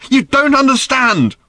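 put-on a teenage girl's high, loud furious cry; angry shout. A narrator's reading. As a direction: yell it at him, fed up.

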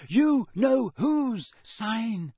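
high, loud, very drawn-out words; frightened moan. A narrator's reading. Hermione warns Harry of the sign, frightened moan. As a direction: spell out the horror slowly, moaning. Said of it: "Hermione moaned".

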